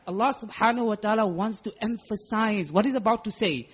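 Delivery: ordinary talk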